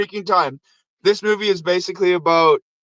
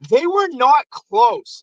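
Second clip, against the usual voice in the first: They were not close drawn out high and slow; stress on not close; 6 semitones higher, much slower than usual. Shown as a demonstration. Telling viewers how far apart the cities are, slow emphatic. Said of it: "emphasising the distance".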